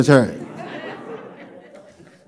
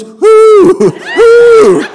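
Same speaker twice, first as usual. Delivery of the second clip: very high, loud owl hoot; 9 dB louder than usual. Retold or imitated. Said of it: "Nowhere, he goes".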